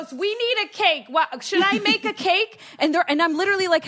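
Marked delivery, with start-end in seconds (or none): 0.1-2.6 high-pitched mock mother; hammed-up high mom voice; fussing mom. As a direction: imitate a mom making a big deal. Retold mom fussing over cake.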